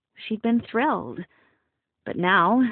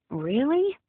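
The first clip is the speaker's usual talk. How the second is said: surprised; 7 semitones higher than usual. Acted. Startled by silent helpers.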